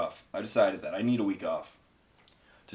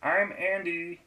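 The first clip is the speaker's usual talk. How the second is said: much higher, slow Kermit-ish friend voice; higher cartoonish Andy voice; Andy impression; 10 semitones higher, slower than usual. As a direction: drawl it high like a goofy frog puppet. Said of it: "Kermit the Frog, or, you know, Harold Ramis".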